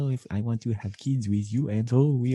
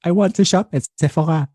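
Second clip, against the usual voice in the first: high, loud mock French whine; hammed-up French accent; 9 semitones higher, 5 dB louder than usual. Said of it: "That's a good accent right there".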